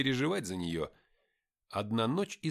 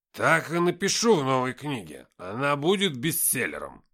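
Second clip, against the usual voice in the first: Никита; 8 dB louder than usual. Acted. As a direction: proclaim it like a drunk inventing a legend. Drunken writer Nikita boasts. (громкое пьяное бахвальство)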